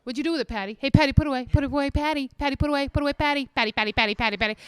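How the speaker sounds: monotonous, needling husband imitation; pestering husband voice. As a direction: chant the nickname at her over and over. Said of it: "he starts calling me Patty Put Away".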